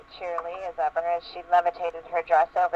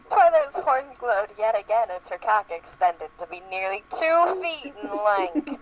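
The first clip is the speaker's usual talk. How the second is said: ordinary talk, nothing marked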